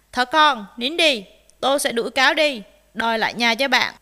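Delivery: loud, brisk promise; confident reassurance. Acted. Rooster comforts crying rabbit, bold and reassuring. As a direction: console with bold assurance.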